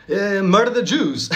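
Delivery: badly faked Scottish accent, drawn out; failed Scottish accent. Sir Alex imagined inciting violence, in a poor Scottish accent. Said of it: "Can't do a Scottish accent".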